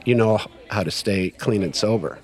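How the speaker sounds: low, measured mentor voice; solemn verdict. Retold or imitated. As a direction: deliver the sponsor's lesson calmly, with pauses. Sponsor's solemn closing verdict, retold.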